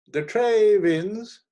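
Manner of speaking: loud, high, drawn-out cry of victory; triumphant gambler. A narrator's reading. Hermann shows his winning card, loud triumphant call. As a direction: call the win slowly and high.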